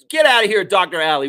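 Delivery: playful shouted banishment; mock dismissal. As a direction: throw the doctor out loudly. Mock dismissal of rival doctor.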